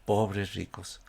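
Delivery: soft repeat. Shown as a demonstration. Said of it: "Como dicen algunos".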